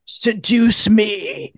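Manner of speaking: very slow, drawn-out mock seductive order; slow demand. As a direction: stretch every word like a sleazy director. Stryker's slow demand, imitated.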